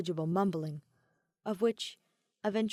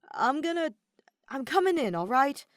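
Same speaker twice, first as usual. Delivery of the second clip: loud and higher, warning before barging in; urgent call; 6 semitones higher, 7 dB louder than usual. Acted. Aventurine warns Ratio he is coming in, loud and urgent. Announcing entry through door.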